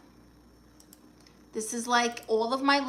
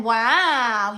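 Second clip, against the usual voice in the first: ordinary talk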